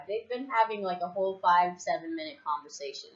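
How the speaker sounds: normal talking voice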